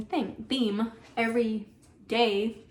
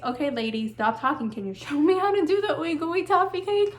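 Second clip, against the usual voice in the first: impatient viewer; 5 semitones higher than usual. Retold or imitated. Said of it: "you're probably just like".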